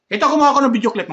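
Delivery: high, fast, loud intro to the clip; energetic vlogger announcement; excited intro. Real, not acted. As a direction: hype the viewers up for the clip.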